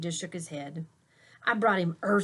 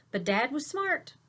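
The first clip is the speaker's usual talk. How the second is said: plain reading voice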